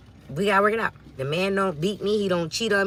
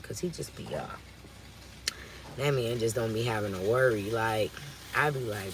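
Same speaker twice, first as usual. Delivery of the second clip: normal talking voice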